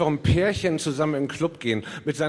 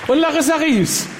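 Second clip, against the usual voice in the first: slow, high, loud announcer call; grand entrance announcement; 9 semitones higher, 8 dB louder than usual. Acted. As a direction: draw the name out like a host. Letzte Ansage. (langgezogene Ansage)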